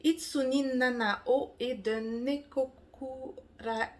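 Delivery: put-on slow, careful Japanese accent on the names. Naming the manga's authors to viewers, slow Japanese accent. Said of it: "Excusez mon accent japonais".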